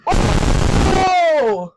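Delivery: loud, high startled yell; startled shout. Real, not acted. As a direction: jump out of your skin. Jump scare hits.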